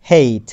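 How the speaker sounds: হেইট loud, with an "ey" glide and falling tune; modelled pronunciation. Shown as a demonstration. Teacher introduces a new word, হেইট projected with ey glide. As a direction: model হেইট clearly for learners, vowel as "ey". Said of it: "নতুন একটা ওয়ার্ডের pronunciation দেখব".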